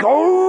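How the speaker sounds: long, high, loud wolf howl; drawn-out animal howl. A narrator's reading. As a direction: throw back a long rising howl. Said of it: "Wolf howled".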